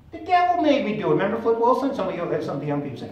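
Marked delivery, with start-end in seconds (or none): 0.1-1.1 high, loud mock excuse; imitating a comedian's high woman character; high-pitched comic impression. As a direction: squeal the excuse in falsetto. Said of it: "with this high-pitched voice".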